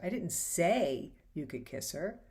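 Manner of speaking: slowly, with say leaned on and a rise; stress on SAY. Shown as a demonstration. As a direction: say drawn out and stressed, slow line. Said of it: "you can change the meaning or tone of the line".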